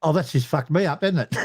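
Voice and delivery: ordinary delivery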